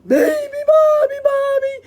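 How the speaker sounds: booming loud chant; thunder. Acted. Moose and buffalo thunder the song. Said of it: "thundered the moose".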